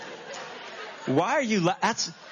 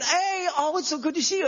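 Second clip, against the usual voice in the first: overeager cheer; 9 semitones higher than usual. Retold or imitated. Dad overly cheerful to daughter.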